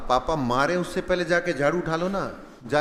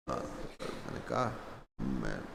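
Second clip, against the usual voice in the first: whispered, choked-off; suppressed anger; 17 dB quieter than usual. Retold or imitated. Big brother holding in anger, whispering. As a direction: bite it back under your breath.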